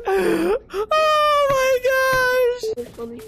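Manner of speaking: high, loud frantic shouting; panicked screaming. Real, not acted. Gamer who thinks he's gonna die, screaming in panic. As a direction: shriek in escalating panic, stretching the last cry.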